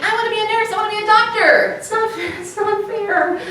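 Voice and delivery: put-on high-pitched kid declaring a career; mock eight-year-old. Comic imitating ambitious eight-year-olds, mock eight-year-old. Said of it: "they're eight years old. They're like".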